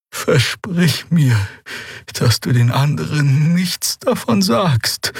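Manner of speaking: langsam und hoch, eindringlich; ernste alte Draxyl. A narrator's reading. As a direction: ihn ernst zum Versprechen drängen. Xea bittet ernst. (ernste Bitte der alten Heilerin)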